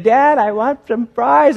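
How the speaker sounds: put-on whiny toddler. Toddler son voiced in story.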